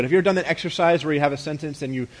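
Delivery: usual speaking voice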